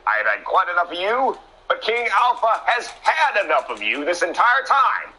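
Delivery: a hint of a fed-up El Diablo; voicing El Diablo. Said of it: "El Diablo has said".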